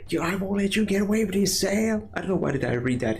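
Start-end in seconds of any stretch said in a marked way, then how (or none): 0.1-2.1 high, fast hillbilly Gohan squeal. Pretend. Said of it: "in that, like, hillbilly Gohan voice".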